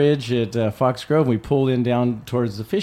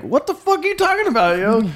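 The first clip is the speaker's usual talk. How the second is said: high, far-off disbelieving voice for Carl; incredulous what-the-fuck; 13 semitones higher than usual. Retold or imitated. Carl staring at the teller, asking what he's talking about, incredulous. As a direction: voice a stunned Carl, pitched way up. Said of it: "Carl's looking at me like".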